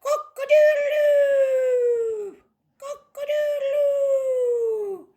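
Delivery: very high crow with a long-held last note; rooster crow. Acted. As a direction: crow loudly to wake the farm. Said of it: "Rooster woke up with a".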